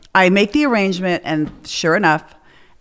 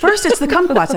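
laughing mock rant; 9 semitones higher than usual. Retelling her drunk rant.

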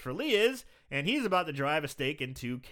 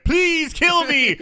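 high, loud, slow mock plea; begging werewolf; 12 semitones higher, 10 dB louder, much slower than usual. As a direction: beg for death in a hammed-up whine. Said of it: "He's like".

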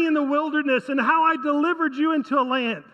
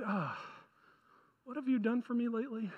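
quick, soft, offhand brush-off; dismissive; 3 semitones lower, 13 dB quieter than usual. Acted. Israel answers God's reminder of past care, dismissive. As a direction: shrug it off under your voice, much softer.

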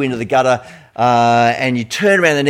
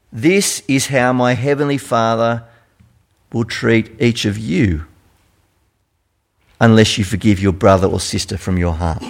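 solemn scripture reading; 4 semitones lower than usual. Acted. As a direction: let each word land gravely. Reads the verse.

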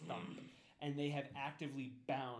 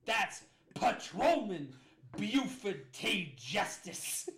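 loud, high, slow pompous lawman; sheriff Buford T. Justice; 6 semitones higher, 10 dB louder, much slower than usual. Acted. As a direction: drawl it out like a self-important sheriff. Sheriff corrects his title.

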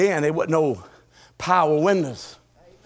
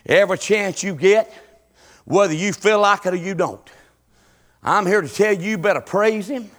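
ordinary talk

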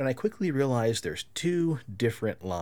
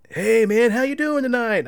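high, loud, friendly celebrity hello; a hammed-up cheerful star greeting a fan; upbeat Bruce greeting; 12 semitones higher, 8 dB louder than usual. Retelling Bruce greeting a fan, high and bright.

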